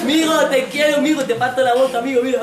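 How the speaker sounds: put-on loud high threatening kid; tough little boy. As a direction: bark it like a scrappy boy. Niño peleón en guardería. (niño chulito amenazando a gritos)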